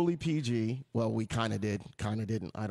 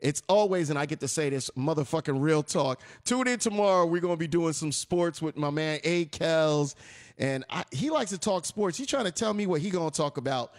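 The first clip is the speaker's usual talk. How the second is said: ordinary talk, nothing marked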